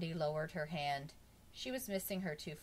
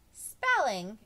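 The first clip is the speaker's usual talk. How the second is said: loud, higher exclamation of "Spelling!"; exclaimed; 5 semitones higher, 11 dB louder than usual. A narrator's reading. Jenny exclaiming at Bob's favorite subject, loud disbelief. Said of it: "exclaimed Jenny".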